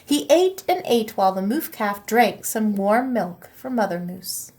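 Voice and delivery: usual speaking voice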